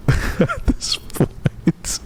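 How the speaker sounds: high, slow words broken by laughing; real laughter. Real, not acted. Streamer reading chat's tease about being hopeless, helpless laughter. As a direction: barely get the words out for laughing.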